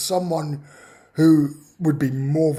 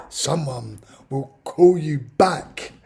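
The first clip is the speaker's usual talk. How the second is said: slow, drawn-out and louder, mocking the stock answer; slow mock repetition; 5 dB louder, much slower than usual. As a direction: spell out the broken-record reply slowly. Mocking the repeated same answer.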